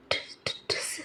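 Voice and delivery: very soft, voiceless murmur; faint feverish whispering; feeble whisper. Acted. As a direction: whisper it as if too weak to speak. Feverish girl mumbling weakly.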